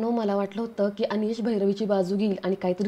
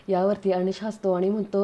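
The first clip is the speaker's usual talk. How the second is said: ordinary delivery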